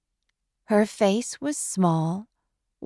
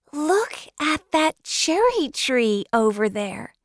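put-on high, delighted child pointing out the blossoms, rising louder; happy chatter; 9 semitones higher, 3 dB louder than usual. Girl chatters to Matthew on the drive, happily and high. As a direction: gush over the cherry tree like an excited girl. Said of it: "she said happily".

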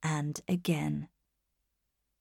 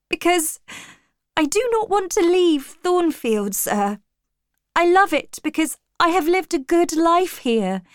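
high, pleading outpouring on the edge of tears; acted upset, pitched high all through; tearful distress; 11 semitones higher, 4 dB louder than usual. A narrator's reading. Jane tells her master why she cannot bear leaving, tearful distress. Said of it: "suddenly I began to cry".

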